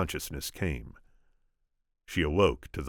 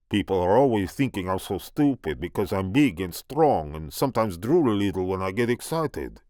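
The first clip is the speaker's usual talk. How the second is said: put-on booming French accent; 4 semitones higher, 5 dB louder than usual. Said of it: "booming French accent of Andre the Giant".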